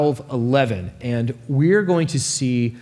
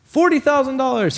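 much higher, called out across the crowd; rival outbids; 11 semitones higher than usual. Acted. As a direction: top his bid from the back. Rival bids across auction crowd.

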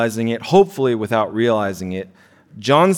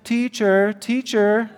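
high whiny tattletale call; sing-song voice; 10 semitones higher, slower than usual. Retold or imitated. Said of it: "read this in sort of a sing-song voice".

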